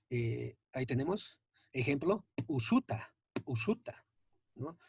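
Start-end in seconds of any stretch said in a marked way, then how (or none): 2.5-4.1 "usuta" opened with a popped p', then a tiny catch; explosive P. Shown as a demonstration. Teacher demonstrates P with apostrophe, explosive start on "usuta". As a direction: pop a hard p' straight before "usuta", no puff. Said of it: "produce el sonido con una explosión".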